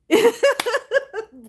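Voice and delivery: high burst of real laughter; a bright cackle at her own joke. Real, not acted. Streamer laughing at a chat joke.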